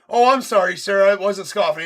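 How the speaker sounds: high, loud mocking clerk voice; mock clerk apology. The clerk denying she scoffed, high mock apology. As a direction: squeak out a fake apology. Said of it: "She's like".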